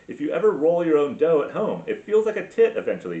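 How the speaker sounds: ordinary delivery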